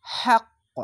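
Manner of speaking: হাক্ক cut off hard on the qaf, high; wrong stopped pronunciation. Shown as a demonstration. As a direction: stop dead on হাক্ক. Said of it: "এভাবে থেমে যেতে হতো".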